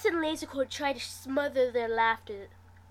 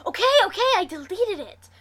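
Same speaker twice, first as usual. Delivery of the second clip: higher, louder hurried backing down; panicked; 9 semitones higher, 8 dB louder, faster than usual. A narrator's reading. Brother caving to his sister, panicked. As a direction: blurt it out to stop her. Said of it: "cuts me off in a panic".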